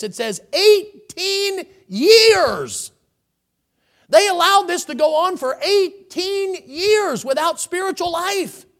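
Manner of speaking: high, loud and slow, hammering eighteen years; drawn-out pulpit emphasis on "eighteen years"; slow emphatic preaching. Real, not acted. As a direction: lean hard on every word like a preacher.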